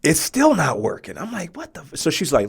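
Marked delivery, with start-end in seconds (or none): 1.6-2.0 high, cut-off exclamation; shocked. Retold or imitated. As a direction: blurt it in pained shock. Pain not going away.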